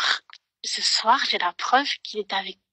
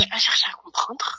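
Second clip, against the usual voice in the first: whispering; hushed whisper; chuchotement. Real, not acted. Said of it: "en chuchotant". (voix chuchotée)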